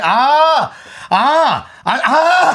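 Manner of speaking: high, loud "aah" bursts; hammed-up dawning-horror exclamations; exclamations of realisation. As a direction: let the penny drop in escalating cries. Host's exaggerated realisation noises.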